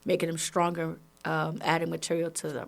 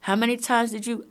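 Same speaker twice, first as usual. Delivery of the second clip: ordinary talk, nothing marked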